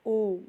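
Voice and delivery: ओम stretched very long and breathy, almost whispered; drawn-out om. Shown as a demonstration. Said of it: "प्लुत स्वर कहा जाता है".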